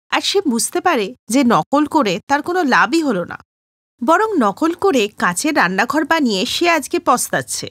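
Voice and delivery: usual speaking voice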